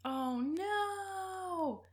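ordinary talk, nothing marked

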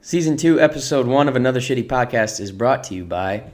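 announcer voice for a fake sponsor; mock commercial announcer; ad read. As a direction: read it like a radio spot.

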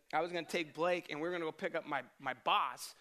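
ordinary talk, nothing marked

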